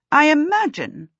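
high, emphatic dry remark; a touch of dry disdain. A narrator's reading. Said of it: "said dryly".

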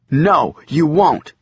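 slow, loud, final; firm boy. A narrator's reading. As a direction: lay it down slowly and firmly.

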